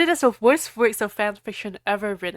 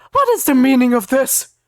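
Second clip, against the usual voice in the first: loud stern demand; angry; 3 semitones higher, 8 dB louder than usual. Acted. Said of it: "Dumbledore asked all angrily".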